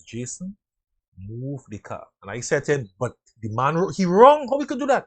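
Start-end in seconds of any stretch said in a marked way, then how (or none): 0.0-2.1 quiet, steady voice of a supervisor; calm quiet order. Retold or imitated. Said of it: "My supervisor very calmly says". 3.0-5.1 indignant protest. Retold or imitated. Young driver protesting to supervisor.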